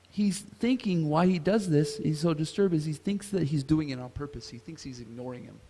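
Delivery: usual speaking voice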